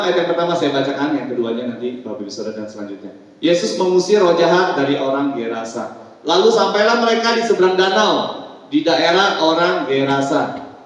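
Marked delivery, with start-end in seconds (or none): 3.4-10.7 loud, projected Bible reading; formal, emphatic recitation; scripture reading. Acted. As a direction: read the passage out to the congregation. Pastor reads scripture aloud.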